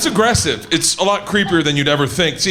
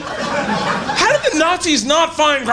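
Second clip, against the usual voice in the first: indignant mock shout; 9 semitones higher, slower than usual. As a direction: demand it of the room, hammed-up.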